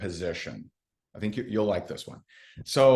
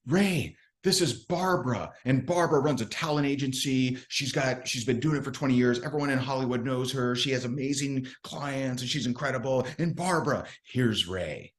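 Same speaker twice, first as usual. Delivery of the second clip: higher, gushing introduction; gushing introducer; 3 semitones higher than usual. Retold or imitated. Rep introducing Barbara to Ray, higher and gushing. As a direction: gush over the prospect while introducing. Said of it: "she goes".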